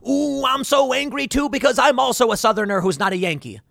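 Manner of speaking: high, cartoonish fuming; hammed-up me-too indignation; mock angry neighbor. Neighbor joins in the mock anger.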